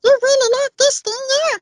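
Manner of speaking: very high squeaky mouse voice; cartoon mouse voice. Shown as a demonstration. Voice actor demos a full caricature mouse pleading for his cheese, squeaky mouse voice. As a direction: squeak like a tiny scared mouse. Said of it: "go on full caricature as though, "Yeah, I'm a mouse".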